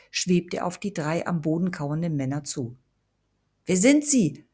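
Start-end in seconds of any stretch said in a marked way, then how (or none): 3.6-4.4 höher und lauter herausgeplatzt; viel zu hohe Stimme. A narrator's reading. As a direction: vor Schreck zu hoch gefragt. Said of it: "mit einer viel zu hohen Stimme". (Mark fragt erschrocken mit viel zu hoher Stimme)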